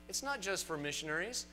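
ordinary talk

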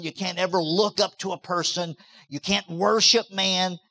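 usual speaking voice

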